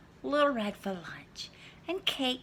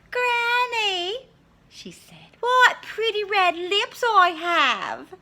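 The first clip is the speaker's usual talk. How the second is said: put-on high, loud, slow vain girl; Little Red; 13 semitones higher, 9 dB louder, slower than usual. Little Red admiring herself to Granny, high and slow.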